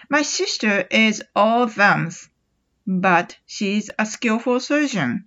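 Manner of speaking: very slow, careful model reading; slow example sentence. Shown as a demonstration. Teacher reads the example slowly for students. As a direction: spell it out slowly for learners. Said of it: "ちょっと例を挙げてみます".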